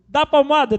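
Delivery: put-on loud, high little-boy demand; imitated daring child. Nephew daring uncle to spank him, loud imitated child. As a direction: call it out like a cheeky kid.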